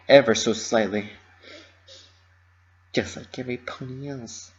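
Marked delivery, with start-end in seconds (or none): none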